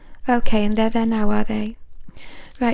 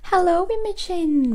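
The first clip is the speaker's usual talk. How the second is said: drawn-out mock greeting; 8 semitones higher, slower than usual. Greets rude coffee customer.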